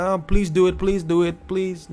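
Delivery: high, whiny mock begging; mock pleading. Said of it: "we can't just be like".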